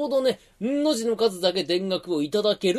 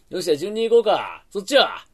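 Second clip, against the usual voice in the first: ordinary talk, nothing marked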